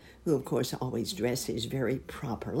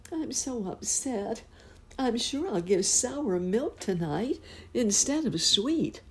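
higher, fretful cow lady voice; upset cow; 7 semitones higher than usual. Acted. Cow lady telling Uncle Wiggily her worry, upset.

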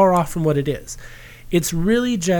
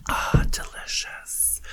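whispered; 7 dB quieter, slower than usual. Real, not acted. Sipping a cocktail.

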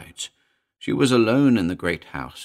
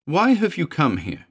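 raised, fretful woman's voice; querulous and frightened, pitched up; high querulous dread; 9 semitones higher, 4 dB louder than usual. A narrator's reading. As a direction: question him, high with dread. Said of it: "a voice which rose high and querulous".

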